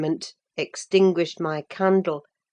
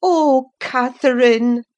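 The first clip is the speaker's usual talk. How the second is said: louder, slow, sorrowful cry; crying sorrowfully; 7 semitones higher, 8 dB louder than usual. A narrator's reading. Ellen hurt by Catherine's lie, sorrowful cry. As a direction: let the grief pour out on her name. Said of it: "I cried sorrowfully".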